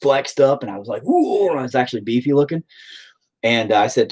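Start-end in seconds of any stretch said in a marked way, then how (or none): 1.0-1.5 high, rising mock-impressed ooh. Recalling the flexed picture, high impressed ooh. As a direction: coo it high in playful admiration. Mimes own impressed reaction to flexing.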